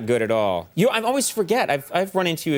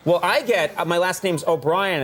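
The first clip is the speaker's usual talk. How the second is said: ordinary delivery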